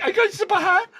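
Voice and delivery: very high, loud comic squeak; mock-flustered yelp; starstruck squeal. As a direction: squeak it out, overwhelmed. Retold stunned reply to Connery.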